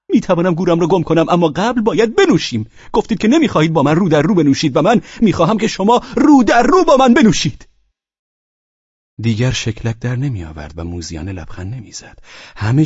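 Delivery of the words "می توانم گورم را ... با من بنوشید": high, loud, mounting outburst; drunkard's heated demand. Acted. Drunken guest demands they drink face to face, heated shouting.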